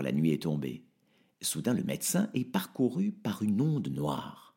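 ordinary talk, nothing marked

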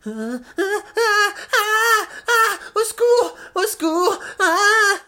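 shrill screaming for help; terrified cries for help. Acted.

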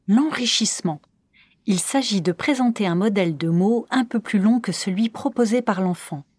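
ordinary delivery

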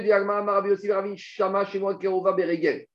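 normal talking voice

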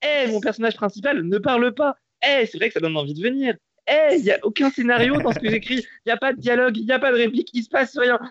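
voix haut perchée et ironique, rôle de la série; voix aiguë moqueuse. Acted. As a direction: jouer la série qui se vante mal, très aigu. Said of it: "j'ai un bouton pour t'enlever la voix". (voix de personnage aiguë et sarcastique)

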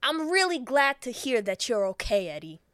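loud, hammed-up mobster voice; put-on gangster checking up on someone; forceful tough-guy delivery; Joe Pesci. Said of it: "I imagine Joe Pesci".

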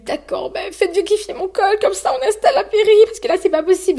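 high, tearful, breaking voice; crying plea. Retold or imitated. Mother in labor begs the midwife to check her cervix, crying. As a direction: plead through tears. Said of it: "vraiment, je pleure".

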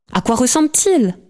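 high, excited questioning; burning to know, pitch climbing high; eager question. A narrator's reading. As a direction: ask it with rising eagerness. Impatient girl asks about the visitor.

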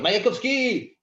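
high loud exclaimed call; shouted name. Retold or imitated. Bar patrons shouting poet's name.

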